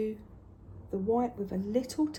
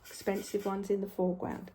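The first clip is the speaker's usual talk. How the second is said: ordinary talk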